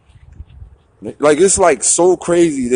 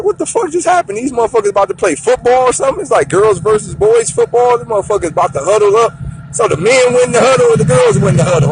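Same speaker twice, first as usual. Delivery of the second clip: much higher, fast, bewildered; baffled; 8 semitones higher than usual. Retold or imitated. Man at family party shocked as guests split up, high and fast. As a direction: rattle it off in disbelief. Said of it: "I'm just standing there like".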